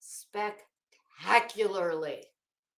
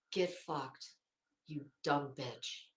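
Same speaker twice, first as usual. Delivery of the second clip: quiet; 9 semitones lower, 10 dB quieter, slower than usual. Retold or imitated. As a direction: lower and softer. Voicing the document's hidden message.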